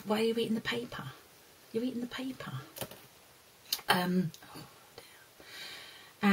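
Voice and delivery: soft whispered aside. Real, not acted. Aside about the dog eating paper.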